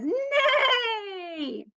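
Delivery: high, whinnying mock neigh; whinny like a pony for the kids; neighing horse. Teacher to toddlers, a high whinnying horse neigh.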